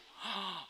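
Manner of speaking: sharp gasp of amazement; big acted gasp; astonished gasp. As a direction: gasp as if spotting a wonder. Acts friends spotting flying frog.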